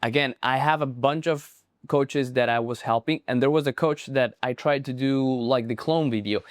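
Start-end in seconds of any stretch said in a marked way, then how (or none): none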